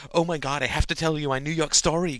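a hint of gushing schoolgirl; imitated gossipy girl. A narrator's reading. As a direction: lightly mimic an ex-friend dying to share her story. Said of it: "They imitated the conversations of their ex-friends".

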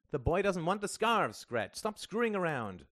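high, loud exasperation; stern order. A narrator's reading. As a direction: put a stop to the nonsense. Al orders Greta to stop.

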